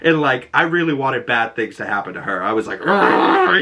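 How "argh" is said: raised growl of frustration; angry growl. Retold or imitated. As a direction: growl it out like a fuming moviegoer. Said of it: "so angry in the theater".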